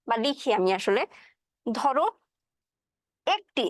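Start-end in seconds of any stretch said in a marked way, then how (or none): 3.3-3.7 একটি said with a clear open e, as in এক; Bengali e sound. Shown as a demonstration. Teacher shows students the e in একটি, clearly. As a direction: say একটি starting with a plain e vowel. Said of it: "এই যে এ ধ্বনিটা".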